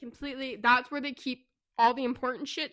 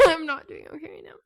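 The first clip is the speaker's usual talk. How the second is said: real tearful laugh, higher and louder; laughing and crying; 6 semitones higher, 10 dB louder than usual. Real, not acted. Co-host reacts to a book death, laughing through tears. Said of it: "She's crying".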